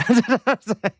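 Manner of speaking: short high burst of laughter. Real, not acted. Laughs after quote.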